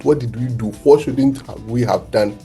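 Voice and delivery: normal talking voice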